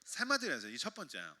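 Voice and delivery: ordinary delivery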